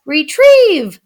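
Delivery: high, loud command to a pet; boy commanding his pet. A narrator's reading. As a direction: call out the order. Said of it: "said Louis".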